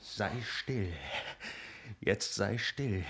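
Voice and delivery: quiet threat. Acted. Man threatens her quietly.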